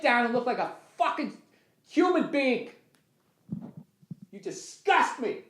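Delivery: high, quiet drill-sergeant voice at his reflection; mirror pep talk. Acted. Man berating his reflection, high and hushed. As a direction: coach yourself in the mirror with contempt. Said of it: "I gotta talk to myself in the mirror".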